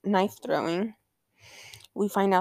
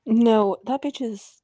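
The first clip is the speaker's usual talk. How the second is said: normal talking voice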